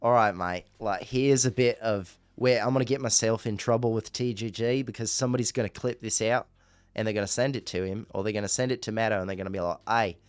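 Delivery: broad Aussie yacht-captain accent; Australian accent. Shown as a demonstration. Streamer asked by chat, mimicking a reality-show captain, Australian accent. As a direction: put on a teasing Australian accent. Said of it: "Do you know how to do an Australian accent?".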